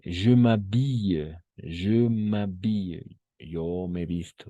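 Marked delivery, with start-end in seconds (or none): 0.1-3.2 "Je m'habille" stretched out slowly; slow teacher demo; slow model pronunciation. Shown as a demonstration. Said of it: "yo le he conjugado todo".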